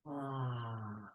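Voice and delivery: soft, long sigh out; sighing exhale. Shown as a demonstration. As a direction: release the breath with a sigh. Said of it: "exhaling with a sigh".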